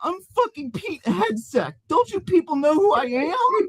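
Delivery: high crybaby voice; crybaby whine. Acted. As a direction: whine as a spoiled big shot nobody recognizes. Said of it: "The Laughing Man continued to cry and pout".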